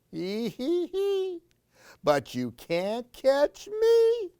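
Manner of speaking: mock giggle rising high; giggling boy. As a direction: tease like a child running away. Gabriel giggles.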